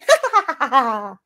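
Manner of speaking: acted cackling leprechaun laugh; mischievous put-on "ha-ha-ha" laugh; leprechaun's burst of laughter. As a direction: burst out in staccato mock laughter. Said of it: "Seamus bursts out laughing".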